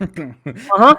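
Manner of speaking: loud, tight uh-huh with a questioning lift; holding it in. Retold or imitated. Fuming player answering the apologetic host, holding it in. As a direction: acknowledge him while barely keeping your cool. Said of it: "just looking at him like this".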